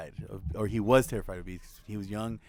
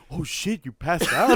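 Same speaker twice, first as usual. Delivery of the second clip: mimicked astonishment; 6 semitones higher, 8 dB louder, slower than usual. Mimics friend's astonished reaction.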